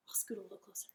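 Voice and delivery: soft whispered aside. Shown as a demonstration. Storyteller whispering to kids to scoot closer. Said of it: "we're gonna do the whole thing quiet".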